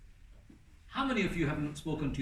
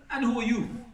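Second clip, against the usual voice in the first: rude challenge; 8 semitones higher, 4 dB louder than usual. Retold or imitated. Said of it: "Like this".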